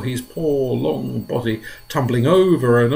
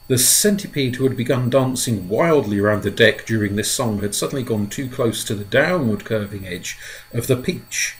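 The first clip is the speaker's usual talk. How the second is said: ordinary delivery, nothing marked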